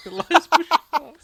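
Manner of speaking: slow, high, loud laughing delivery; laughing pun. Real, not acted. Host repeating the name as a toilet pun, laughing. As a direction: savour the pun while laughing.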